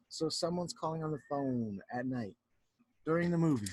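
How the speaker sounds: plain reading voice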